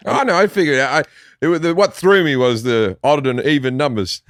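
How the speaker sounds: ordinary delivery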